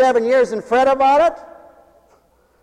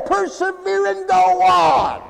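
high, slow, shouted command; preaching shout; 8 semitones higher, slower than usual. Real, not acted. Preacher urging his congregation on, slow high shout. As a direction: drive it home like a revival preacher.

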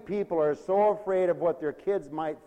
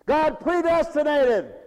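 loud, high preaching cry; full-force preaching; 9 semitones higher, 9 dB louder than usual. Acted. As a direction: shout it out to the congregation.

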